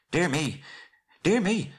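high-pitched fussy little voice; flustered hobbit. Acted. Bilbo flustered by the insult.

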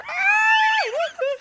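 very high cartoon yell; cartoon scream. Real, not acted. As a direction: howl like a cartoon character on fire. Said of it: "cartoons when someone gets set on fire".

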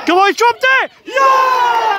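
high, loud excited cry; celebrating. Real, not acted. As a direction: leap up in delight. Celebrating a dropped ball.

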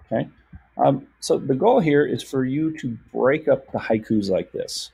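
ordinary talk

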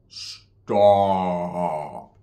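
deep, dragged-out slow-motion stop; slow-motion movie voice. Retold or imitated. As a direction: stretch it low like a slowed tape. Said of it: "In slow motion".

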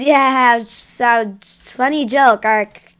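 usual speaking voice

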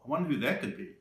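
usual speaking voice

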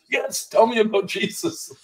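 high, eager imitation; retold eagerness.

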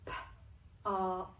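P-ark. split into p and ark; chopped word. Shown as a demonstration. Teacher chops the word with the children, segmented sounds. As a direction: say P-ark. as separate sound chunks. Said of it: "Let's do it all together".

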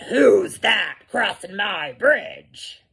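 loud, slow troll challenge; mean, mad troll. A narrator's reading. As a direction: demand it like an angry bridge troll. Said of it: "he was mean and mad and said".

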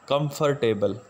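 कंफर्टेबल again as kum-fur-TAY-bul with full -ter- syllable; wrong pronunciation. Shown as a demonstration. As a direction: repeat the mistaken four-syllable कंफर्टेबल. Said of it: "मैं ये दोबारा repeat करता हूँ". (कंफर्टेबल फिर से गलत उच्चारण में)

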